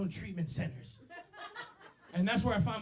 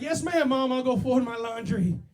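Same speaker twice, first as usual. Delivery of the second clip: loud, higher mock obedience, slowing down; loud obedient son; 4 semitones higher, 10 dB louder, slower than usual. Son loudly obeying his mom, mock. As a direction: yell your obedience back to Mom. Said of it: "I was like".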